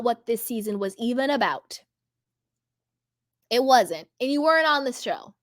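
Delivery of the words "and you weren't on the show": ordinary talk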